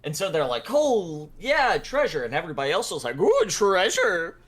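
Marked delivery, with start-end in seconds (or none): 0.7-2.2 loud, high treasure-finder's cheer; retold party excitement; mock delight. Party reacts to finding treasure. 3.2-4.4 slow, high, loud "ooh"; hammed-up wonder at riches; greedy awe. Others covet the treasure.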